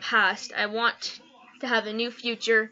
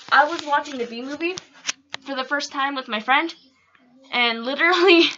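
ordinary talk

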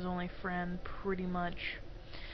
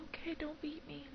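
mock meek; 7 semitones higher, 10 dB quieter than usual. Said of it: "I'm just kidding".

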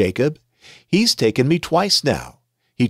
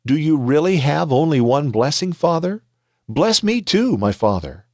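mild tearful plea rising higher on father and too; weeping plea; 6 semitones higher than usual. Acted. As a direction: let a small catch of grief into the begging. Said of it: "And Esau wept loudly".

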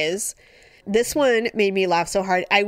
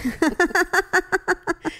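high laugh; 11 semitones higher than usual. Real, not acted. Laughing at memory.

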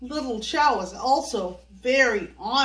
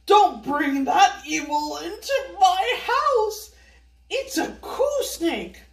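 fearful scolding; 5 semitones higher than usual. Acted. Old woman wards off with broom.

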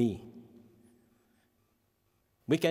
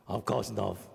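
put-on glum teen; 4 semitones lower, 3 dB quieter, slower than usual. Teen's glum reply quoted.

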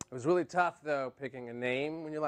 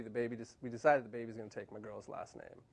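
normal talking voice

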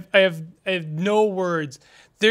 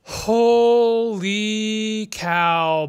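drawn-out holy cow; 4 semitones higher, 3 dB louder, much slower than usual. Real, not acted. Drawn out reaction to loss.

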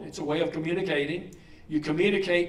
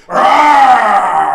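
put-on huge high kid's roar of rage; boy running in circles, roaring his frustration; furious roar; 4 semitones higher, 19 dB louder than usual. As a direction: let out a tantrum roar. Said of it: "using a vocabulary word".

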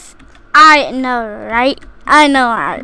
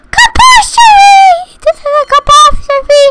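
mock alarm; 13 semitones higher, 4 dB louder than usual. Hammed-up alarm in game.

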